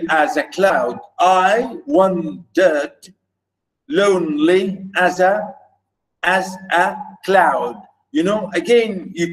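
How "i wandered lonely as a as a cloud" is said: measured metrical demonstration with gaps between feet; exaggerated scansion. Shown as a demonstration. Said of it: "I'm exaggerating maybe a little bit".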